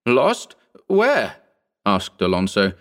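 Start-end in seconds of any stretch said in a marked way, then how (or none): 0.0-1.4 fearful hope. Acted. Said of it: "mingled fear and hope".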